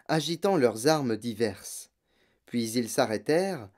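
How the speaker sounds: ordinary delivery, nothing marked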